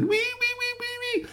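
very high squeaky wees; tiny creature cheer. Acted. Little woodsies cheer about their tree home in tiny squeaks. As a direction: squeal like tiny happy woodland toys.

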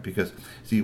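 plain reading voice

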